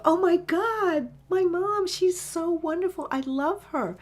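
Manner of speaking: gushing, high and loud, then easing; light up with adoration for mom; lit up. Retold or imitated. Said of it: "he just lit up".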